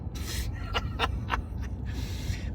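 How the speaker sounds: soft, amused laugh; real laugh. Real, not acted. Vlogger finishes retelling the argument, laughing. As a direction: chuckle at how it ended.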